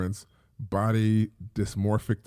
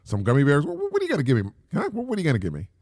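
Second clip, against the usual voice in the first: higher, louder mock-defiant kid; sarcastic comeback; 5 semitones higher, 4 dB louder, faster than usual. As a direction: throw the threat back at the parent, taunting. Mock comeback to threatening parent.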